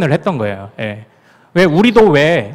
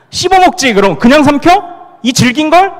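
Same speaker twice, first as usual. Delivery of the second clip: higher, louder playful indignation; mock indignant reply; 9 semitones higher, 4 dB louder than usual. Pastor imagining an outsider's baffled answer, mock indignation. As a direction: answer as if the question were silly. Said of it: "이렇게 얘기할 거 아니에요".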